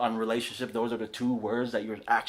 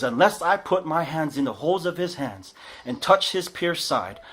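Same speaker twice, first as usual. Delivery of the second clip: higher and louder, hammed-up overstatement; dramatic emotional Thomas; 4 semitones higher, 7 dB louder than usual. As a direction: stretch never out like a drama queen. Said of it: "he gets super dramatic and emotional".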